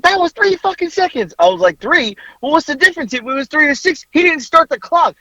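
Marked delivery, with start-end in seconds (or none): 0.0-1.3 very high imitated outrage; high-pitched indignant squawk; heated protest. Retelling her angry courtside argument. 1.8-5.1 high, incredulous comeback; worked-up retort, acted out; heated arguing. Acting out his heated comeback.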